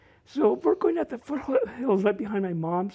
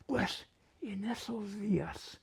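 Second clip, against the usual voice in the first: hushed, soft Spanish story opening; storytelling grandma; 11 dB quieter than usual. Retold or imitated. Grandma opening a story at night, hushed and lightly voiced. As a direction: begin an old tale quietly in the dark. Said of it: "she goes".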